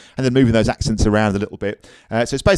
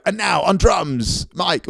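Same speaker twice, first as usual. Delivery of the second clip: announcer call; 7 semitones higher, much slower than usual. Retold or imitated. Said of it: "when they go".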